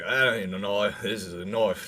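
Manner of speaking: put-on booming Australian bushman accent; Aussie accent. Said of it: "Aussie style".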